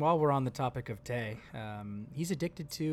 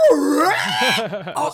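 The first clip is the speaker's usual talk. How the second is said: mock startled yelp; 10 semitones higher, 9 dB louder than usual. Startled in the blind bit.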